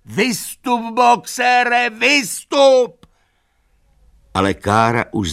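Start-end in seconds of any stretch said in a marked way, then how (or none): 0.0-3.1 very high, loud urgent cries; screaming. A narrator's reading. All animals urge Boxer out, screaming. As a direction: scream for him to get out, building. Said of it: "začala křičet".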